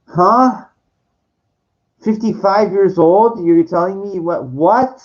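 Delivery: high, loud outraged huh; can't believe what she wrote, rising in outrage; stunned and offended, voice jumping up; indignant disbelief. Real, not acted.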